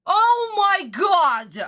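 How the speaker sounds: high, stretched-out exclamation; long, drawn exasperation; exasperated cry. Real, not acted. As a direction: wail it slowly in disbelief. Exasperated exclamation after the outburst.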